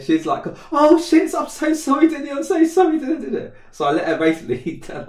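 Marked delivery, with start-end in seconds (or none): 0.7-3.5 high, loud, frantic sorry; panicked apology. Retold or imitated. Friend apologising in a panic, high and loud. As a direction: gush out a horrified apology.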